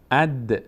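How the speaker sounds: "اد" as one syllable "ad"; short a closing firmly on D; الف joined to دال. Shown as a demonstration. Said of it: "الف رو باید بچسبونیم به دال". («اَد» با دالِ ساکن)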